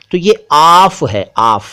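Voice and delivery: आफ said slowly with a long open aa, not ऑफ; careful pronunciation. Shown as a demonstration. Teacher contrasting Urdu आफ with English off. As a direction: linger on the aa in आफ. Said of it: "ऑफ यानी आफ की बात".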